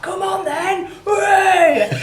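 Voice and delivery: high, loud whooping cheer; mock battle cry. To the hosts, a whooping mock battle cry. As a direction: cheer like a warrior about to negotiate.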